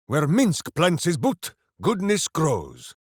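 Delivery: usual speaking voice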